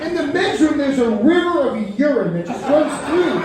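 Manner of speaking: normal talking voice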